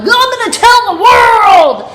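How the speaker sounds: loud, high gleeful taunt; gleeful threat. Retold or imitated. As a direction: shout it like you'll spill the secret.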